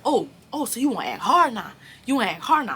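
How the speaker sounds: loud, high mocking dare; calling his bluff, loud and high; retold challenge. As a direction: throw it in his face loudly. Retold confrontation, daring him.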